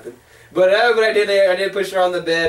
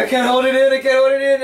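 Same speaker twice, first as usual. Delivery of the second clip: higher-pitched retold desperation; mock urgency; 6 semitones higher than usual. Telling friend how he lost control, mock urgent. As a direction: plead as if about to burst.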